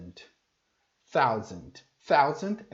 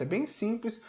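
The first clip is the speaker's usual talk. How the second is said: usual speaking voice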